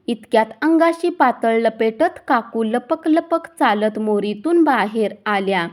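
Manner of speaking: plain reading voice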